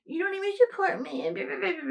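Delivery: high, mocking whiny complaint; whiny guilt trip. Mocking a former friend's angry texts in a whiny high voice. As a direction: parody her guilt-tripping text, high and put-on.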